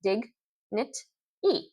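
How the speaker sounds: dig... knit... e, each syllable alone; syllables split apart. Shown as a demonstration. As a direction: break "dignity" into its three beats. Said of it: "the three syllables".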